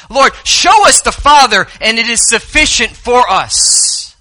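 high pleading request, slowing; Philip asking. Acted. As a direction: beg to be shown the Father. Preacher voicing Philip.

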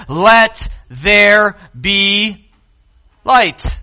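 very slow, drawn-out command; voice of God. Acted. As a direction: stretch each word of the decree. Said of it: "God says".

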